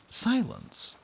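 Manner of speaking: violent command. Acted. Stern aunt silencing the begging child with a high furious command. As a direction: cut her off with one furious word. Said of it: "This violence is almost repulsive".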